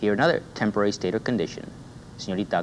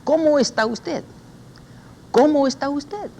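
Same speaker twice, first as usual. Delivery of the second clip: much higher, lilting Cómo está usted; high sing-song greeting; 5 dB louder than usual. Shown as a demonstration. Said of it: "repítala, repita".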